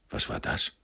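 hushed, baffled question; soft, half-voiced astonishment; astonished. A narrator's reading. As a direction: ask under the breath in surprise. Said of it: "fragte Frau Taube erstaunt".

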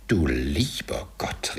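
slow, drawn-out gasp of disbelief; as if the breath is running out; breathless astonishment. A narrator's reading. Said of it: "als ob ihm der Atem ausginge". (atemlos vor Staunen)

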